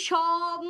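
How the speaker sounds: সম held long as a separate first syllable; drawn-out syllable demo. Shown as a demonstration. Teacher breaks the word up for students, drawn-out syllable demo. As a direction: stretch out সম by itself. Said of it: "বলতেছি".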